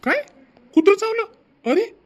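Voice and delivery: high, loud alarmed question; startled disbelief; shocked. Acted. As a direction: jolt up in alarm at the news. Uncle shocked at dog bite.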